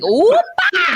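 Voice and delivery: very high, whooping cry of glee; gleeful whoop. Retold or imitated. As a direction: whoop it out like a party cheer. Mock birth cheer, high whoop.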